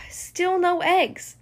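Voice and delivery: slow, let-down sigh into the words; disappointed sigh. Acted. Sisters finding nothing under the rug, disappointed. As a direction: sigh and say it slowly, deflated. Search fails again.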